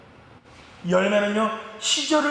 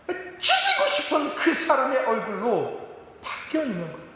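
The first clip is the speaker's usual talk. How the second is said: usual speaking voice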